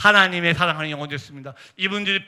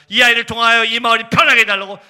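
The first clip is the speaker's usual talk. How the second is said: much louder, sustained plea; retold petition in a big preaching voice; impassioned prayer; 4 semitones higher, 12 dB louder than usual. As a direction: belt out the prayer from the pulpit.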